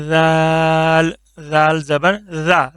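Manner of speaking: زال said thin and slow; slow light زال; thin zaal. Shown as a demonstration. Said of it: "اس کو بھی پتلا کر کے پڑھنا ہے".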